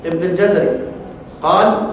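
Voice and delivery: ordinary talk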